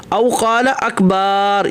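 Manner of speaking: اکبر with the با held long, very slow; stretched ba. Shown as a demonstration. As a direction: drag out the با of اکبر. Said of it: "یا با کو کھینچا". (اکبر کی با کو کھینچ کر)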